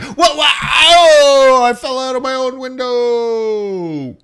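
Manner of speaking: high, loud startled cry of disbelief; man tripping out his office window, loud mock alarm; mock shocked cry. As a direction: yelp in shock, hammed-up. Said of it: "You're like".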